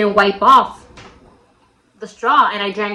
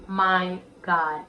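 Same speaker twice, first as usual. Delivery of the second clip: slow, much softer, lower voice; hushed inner thought; 3 semitones lower, 11 dB quieter, much slower than usual. Retold or imitated. Girl thinking to herself after a first kiss, hushed. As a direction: let it sink in slowly, almost to yourself. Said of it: "In my head, I legit was like".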